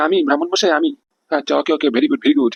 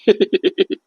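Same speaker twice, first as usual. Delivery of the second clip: smug laugh; 3 semitones higher than usual. Acted. Villain gloats over his plan.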